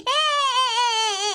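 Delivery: wailing "eh-eh" sobs; hammed-up weeping; acted crying. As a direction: bawl in short repeated cries. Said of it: "இப்படி எல்லாம் அழாதம்மா".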